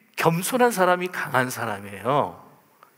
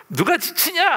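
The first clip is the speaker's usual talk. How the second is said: defiant challenge; 14 semitones higher, 3 dB louder than usual. Retold or imitated. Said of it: "보자는 듯이".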